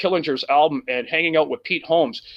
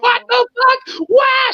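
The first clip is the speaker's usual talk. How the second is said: much higher, louder mock shriek of a woman waking; retold scream; 4 dB louder than usual. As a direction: shriek it in panic, hammed up. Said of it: "She's screaming".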